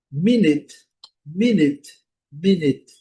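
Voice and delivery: loud "Minute" as "MIN-it"; surprising English pronunciation. Shown as a demonstration. Teacher surprising students with the sound, emphatic "Minute". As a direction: punch out "Minute" with i in both syllables. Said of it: "pode ser até uma surpresa".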